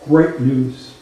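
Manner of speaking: normal talking voice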